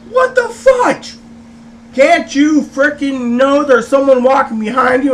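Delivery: high, loud, indignant yelp; exasperated outburst. Retold or imitated. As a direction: rant at a careless driver, drawing out the first words. Irate at driver backing out.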